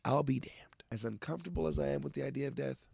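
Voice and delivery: normal talking voice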